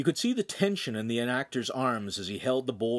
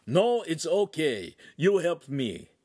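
Italian master; 6 semitones higher, 4 dB louder, slower than usual. Acted.

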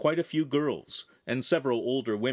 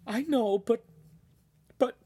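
much higher, half-sobbed words; crying mother; 13 semitones higher than usual. Acted. Charlene unable to let her son go, crying. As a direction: break off through tears. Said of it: "she just kept crying".

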